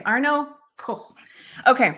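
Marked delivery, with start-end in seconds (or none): 0.8-1.1 Koch tried as "kokh" with a German ch; Koch drawn out with a soft throaty ending; attempted German pronunciation. Shown as a demonstration. Host repeats the corrected German surname.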